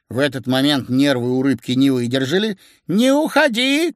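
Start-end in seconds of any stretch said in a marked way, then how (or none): 2.9-3.9 high, drawn-out desperate cry; piercing cry. A narrator's reading. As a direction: cry out piercingly, begging them to stay. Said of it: "крикнула она пронзительно". (пронзительный крик)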